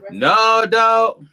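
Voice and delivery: loud protest. Real, not acted. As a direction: push back hard, loudly.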